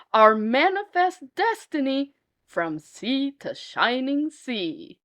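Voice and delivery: slow, drawn-out patriotic declaration; grand slow proclamation. Acted. Jefferson proclaiming manifest destiny to the nation, slow and grand. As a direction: stretch it out like a lofty creed.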